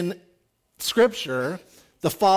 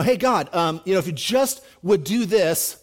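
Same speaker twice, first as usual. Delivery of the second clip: louder, rising desperate promise to God; pleading bargain; 3 semitones higher, 7 dB louder than usual. Retold or imitated. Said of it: "How many of you guys would be like".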